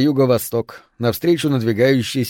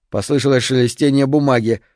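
ordinary delivery, nothing marked

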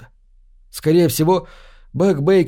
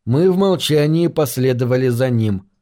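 plain reading voice

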